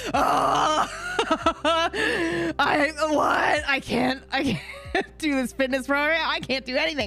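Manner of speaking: high-pitched, hammed-up sobbing; mock wailing. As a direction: wail as if dying, laughing through it. Acts out misery when period hits.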